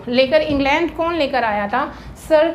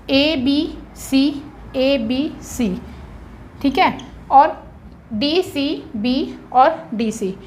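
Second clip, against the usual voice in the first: 1 slow dictation; much slower than usual. Shown as a demonstration. Teacher dictates rhyme scheme.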